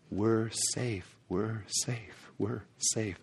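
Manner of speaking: hushed and soft; quiet relief. Acted. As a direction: let it settle, very quietly. Repeating relief softly.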